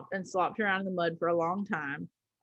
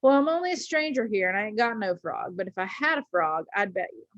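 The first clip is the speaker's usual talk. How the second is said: usual speaking voice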